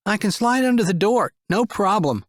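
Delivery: put-on high young boy's voice, confident; keen to help; boy offering to slide under the door; eager boy. A narrator's reading. Boy volunteering.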